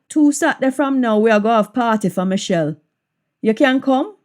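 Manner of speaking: Jamaican patois with a questioning rise. Shown as a demonstration. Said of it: "patois version of the line".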